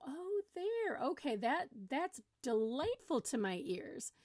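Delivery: high "oh" easing into slow delight; mock relief. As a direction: show exaggerated pleasure at a pleasant sound. Pretend relief at child's normal voice.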